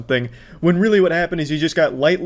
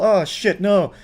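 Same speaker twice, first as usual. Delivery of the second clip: normal talking voice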